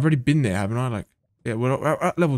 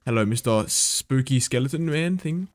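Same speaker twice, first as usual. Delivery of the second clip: normal talking voice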